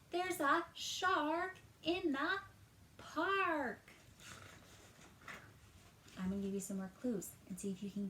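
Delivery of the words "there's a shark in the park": high, loud, slow callout; shouted together. Shown as a demonstration. Teacher leads children shouting Timothy's line. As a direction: shout it out with the kids. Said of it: "Help me shout out what Timothy says".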